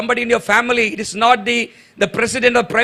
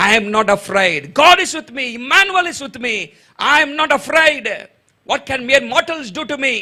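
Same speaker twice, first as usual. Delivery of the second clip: high, emphatic preacher's proclamation; confident declaration of faith; 6 semitones higher than usual. Shown as a demonstration. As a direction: declare it boldly, pitch lifted. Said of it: "you have to say with confidence".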